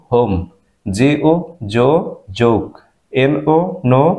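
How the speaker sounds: ordinary delivery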